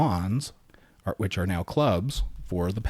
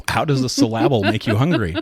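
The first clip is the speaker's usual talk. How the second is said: laughing; 4 semitones higher, 7 dB louder than usual. Real, not acted. Laughing at the salad joke.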